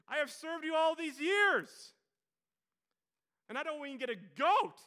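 high, full-voiced indignant shout; oldest brother's outburst. Oldest brother protesting to his father, shouting in outrage. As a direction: yell the grievance at the top of your voice. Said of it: "Then you got the oldest brother says".

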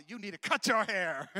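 loud playful command to the crowd; loud teasing pulpit line; loud call. Real, not acted. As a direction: shout it like a joke to the room. Congregation told to say.

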